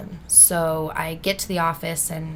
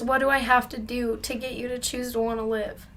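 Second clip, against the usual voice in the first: higher voice, no shouting; earnest appeal; 5 semitones higher than usual. Retold or imitated. Officer sitting down to reach his client, retold earnest appeal. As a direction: plead sincerely, the outburst now spent. Same question softened after he sat down.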